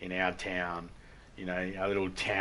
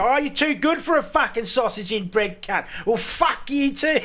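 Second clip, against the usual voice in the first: high, loud mock drunk abuse, laughing through; offended drunk Aussie; 13 semitones higher, 8 dB louder than usual. Rejected drunk abusing a stranger, high loud mock voice. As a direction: ham up the insulted drunk. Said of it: "that's when they're like".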